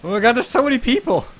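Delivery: high, loud startled exclaim; excited outburst. Real, not acted. Spotting a crowd of strangers, loud excited outburst. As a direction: blurt it out, thrown by the crowd.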